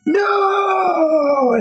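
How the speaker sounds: cried-out high "No!"; high crying shout of despair; anguished shout. Retold or imitated. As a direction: wail it as he collapses. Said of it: "he just like".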